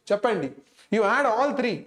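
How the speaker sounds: ordinary delivery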